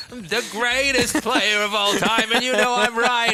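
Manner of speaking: high, slow, loud hype caster; Artosis impression. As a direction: belt it out like a smug caster. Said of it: "Artosis wargs into my mind".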